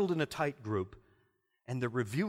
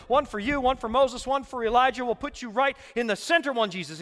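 loud, higher, bubbling with excitement; eager boasting; 10 semitones higher, 9 dB louder than usual. Acted. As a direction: gush like an overeager disciple. Peter's boasting voiced.

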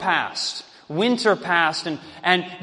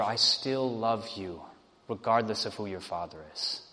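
tender reassurance; 11 semitones lower, 11 dB quieter than usual. A narrator's reading. He holds her, reassures her.

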